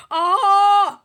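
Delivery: a loud startled "Ah!"; startled gurgling cry. A narrator's reading. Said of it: "he gurgled".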